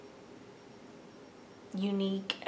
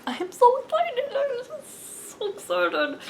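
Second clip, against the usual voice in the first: real excitement. Real, not acted. Vlogger showing viewers her new project, excited squeal. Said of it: "can you tell I'm excited".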